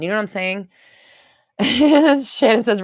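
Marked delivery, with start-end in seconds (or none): 1.6-2.2 laugh. Real, not acted. Laughs at viewer comment.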